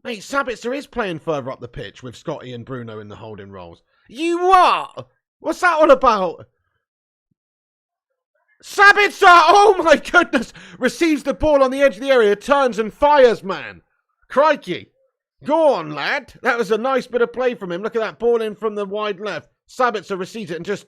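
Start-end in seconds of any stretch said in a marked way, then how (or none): 4.1-6.5 loud high outraged disbelief; baffled outburst at the lineup; incredulous. Real, not acted. Fan reacting to the team sheet, loud incredulous cry. 8.6-16.3 very loud, high commentary yell; excited shout. Real, not acted. Fan commentator as Sabitzer shoots, excited roaring.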